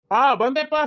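answering from another room, voice pitched high; calling back. Acted. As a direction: call out so the father hears from afar. Son answers a call from afar. (ದೂರದಿಂದ ಎತ್ತರದ ದನಿಯಲ್ಲಿ ಕೂಗಿ ಉತ್ತರ)

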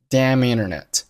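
damn internet joined with no gap; damn internet as "da-minternet"; linked words. Shown as a demonstration. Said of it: "Damn和internet辅元连读".

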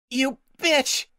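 high furious shout; angry shout. Acted. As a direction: spit it out with rage. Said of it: "Nightshade shouted".

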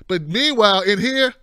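normal talking voice

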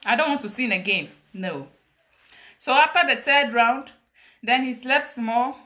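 usual speaking voice

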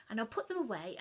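usual speaking voice

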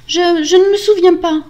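high, loud, stammered start; frightened denial. Acted. Wife denying to angry husband, frightened stammer.

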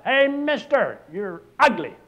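high, loud squawked holler; parrot hollering. Acted. Parrot hollering at the customer from the front, high and loud. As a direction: yell it across the store like a bird. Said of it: "That parrot hollered out".

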